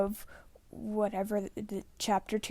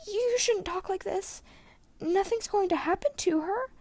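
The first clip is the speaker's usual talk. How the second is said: put-on higher, tearful young protest; voice cracking; 11 semitones higher than usual. A narrator's reading. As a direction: hold back tears while denying it. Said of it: "Aragon's voice cracked".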